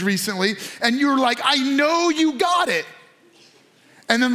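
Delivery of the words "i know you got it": higher, slow, drawn-out complaint; raised-pitch exasperated protest; mock exasperation. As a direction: stretch it out in mock accusation. Acted complaint about unanswered text.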